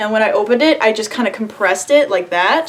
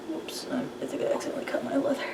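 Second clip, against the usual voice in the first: whispering; 3 semitones higher, 15 dB quieter, much faster than usual. Real, not acted. Accidental cut, muttered aside.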